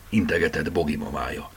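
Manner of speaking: ordinary delivery, nothing marked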